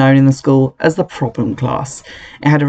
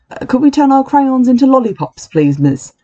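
put-on pleading child voice; 10 semitones higher than usual. A narrator's reading. Greedy boy asks for lollipops.

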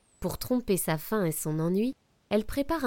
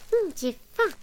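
high squeaky hungry creature; hungry mouse voice; 11 semitones higher, 5 dB louder than usual. Acted. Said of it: "dit une voix".

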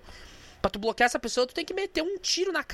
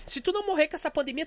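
ordinary talk, nothing marked

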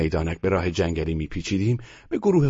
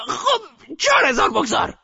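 grandmother shouting. A narrator's reading. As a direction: shout down all objection. Grandmother orders bet.

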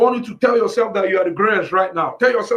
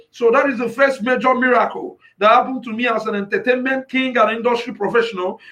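ordinary delivery

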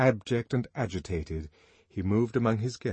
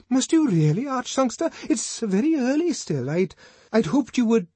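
much higher, louder, anxious pleading; pleading Bernard; 7 dB louder than usual. A narrator's reading. Bernard begging the songster not to leave, high anxious plea. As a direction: beg him to stay, rushing.

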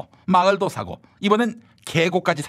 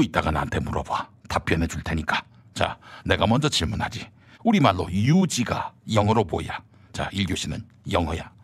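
low stern minister; 3 dB quieter than usual. Acted. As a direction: press him like a cold interrogator. Low rival character.

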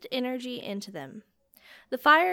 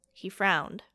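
ordinary delivery

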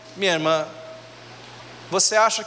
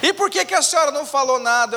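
put-on indignant boy; 6 semitones higher than usual. Boy protests to grandma.

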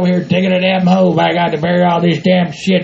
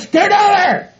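higher-pitched holler at animals; exasperated yell; 10 semitones higher, slower than usual. Acted. Man shooing cows from his hole, exasperated holler. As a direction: call out to shoo them off.

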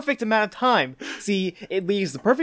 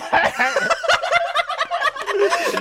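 high burst of laughter. Real, not acted. Cracking up mid impression.

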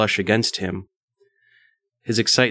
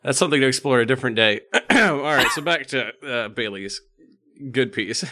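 ordinary talk

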